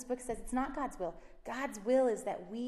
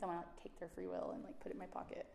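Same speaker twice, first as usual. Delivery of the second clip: whispered aside; 8 dB quieter, faster than usual. Real, not acted. Confiding about her kids.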